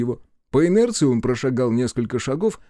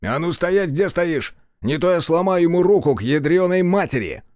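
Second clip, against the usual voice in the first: raised, commanding threat; sharp warning call; 6 semitones higher, 4 dB louder than usual. A narrator's reading. Narrator halting the second policeman, sharp threatening call. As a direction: call out high to freeze him. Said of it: "мой окрик заставил его замереть".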